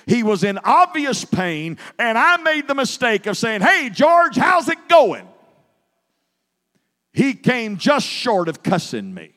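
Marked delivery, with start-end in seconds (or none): 3.6-5.3 calling out a bright hey George; hearty shout of greeting; loud cheery greeting. Retold or imitated. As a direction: boom a breezy hello into the room. Cheerful greeting to man in pain.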